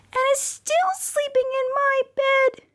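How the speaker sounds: very high, tiny cub voice; teeny weeny Baby Bear voice. Acted. Baby Bear wailing that his porridge is gone, very high and tiny. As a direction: squeak it out like the smallest bear. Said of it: "teeny weeny Baby Bear".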